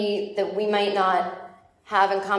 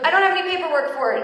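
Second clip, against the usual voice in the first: nervous self; 8 semitones higher, 3 dB louder, faster than usual. Retold or imitated. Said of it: "And I go".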